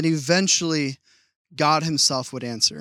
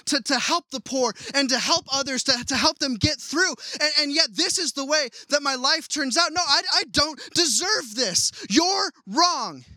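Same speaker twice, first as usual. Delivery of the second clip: Job pleading; 8 semitones higher than usual. Retold or imitated. Job protesting his innocence to God, raised pleading voice. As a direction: voice pitched higher, pleading, rising to a cry. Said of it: "is what Job is saying to God".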